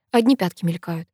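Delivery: ordinary delivery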